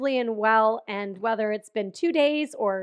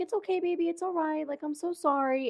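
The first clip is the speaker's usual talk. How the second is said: put-on high, soft baby-talk to a pet; comforting; 6 semitones higher, 3 dB quieter than usual. Retelling consoling her sick dog, tender and high. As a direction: soothe a sick dog, pitched up gently. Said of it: "'cause you're comforting".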